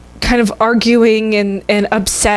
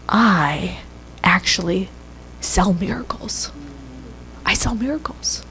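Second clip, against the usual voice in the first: secret whisper; 8 dB quieter than usual. Retold or imitated. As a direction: lean in and whisper it to the child. Surgeon confides to girl privately.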